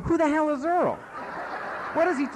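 high, loud astonished mock outcry; a whole room blurting out in disbelief; surprised crowd. Meeting members shocked, a high loud outcry. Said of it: "everybody in the meeting's going".

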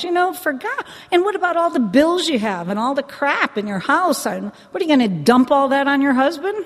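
loud, high-pitched retold telling-off; exasperated scolding. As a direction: scold like you've had enough of them.

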